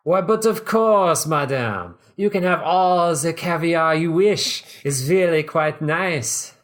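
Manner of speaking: high, slow, hammed-up French waiter accent; loud, drawn-out mock-French flattery; ridiculous accent. A narrator's reading. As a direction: lay on an absurd French accent. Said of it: "ridiculous accent".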